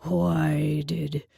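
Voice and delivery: low, slow booming beast voice; booming Minotaur. A narrator's reading. Minotaur demanding why she attacked her ally, booming. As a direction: let it boom slowly from deep down. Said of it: "he boomed".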